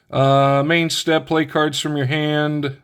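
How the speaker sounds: normal talking voice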